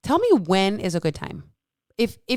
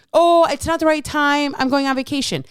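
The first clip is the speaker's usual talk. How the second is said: higher, louder whiny excuse voice; hammed-up whiny excuse; mocking excuse; 6 semitones higher, 4 dB louder than usual. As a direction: parrot the excuse with scorn.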